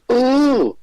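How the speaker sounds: a crowd's shocked groan; audience cry. Retold or imitated. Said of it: "a cry come from the audience".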